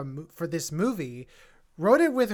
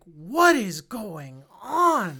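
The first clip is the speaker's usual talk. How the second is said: drawn-out, incredulous question; bewildered, each word dragged out; baffled reaction; 4 dB louder, much slower than usual. Retold or imitated. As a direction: stretch it slowly in disbelief.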